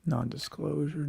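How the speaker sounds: ordinary talk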